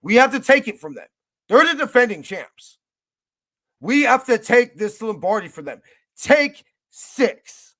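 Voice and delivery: loud, high fired-up fan rally cry; hyped up. Real, not acted. Fan rallying friends before the Super Bowl, fired-up shout. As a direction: pump up the crowd before the game.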